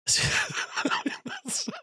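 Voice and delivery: bursts into a high, breathless cackle; sudden squeaky giggle fit; high cackling laugh. Real, not acted. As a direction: cracks up at the picture. Laughing at the split face.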